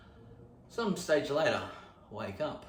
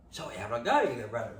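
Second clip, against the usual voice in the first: ordinary talk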